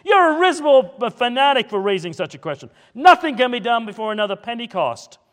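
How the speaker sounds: loud, high, overbearing rebuke. Retold or imitated. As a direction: berate the young upstart at full volume. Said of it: "said it in a raised voice".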